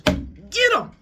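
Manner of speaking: very high, loud yelled command; battle cry. Acted. Zeus yelling at the monsters to attack. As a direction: scream the order to charge.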